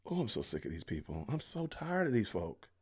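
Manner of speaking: ordinary talk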